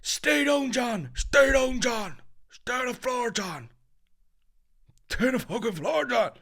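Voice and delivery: high, loud repeated yelling; a man screaming at John to stay down; imitated shout. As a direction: bellow the order over and over. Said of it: "some dude just kept going".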